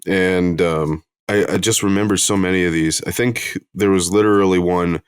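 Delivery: normal talking voice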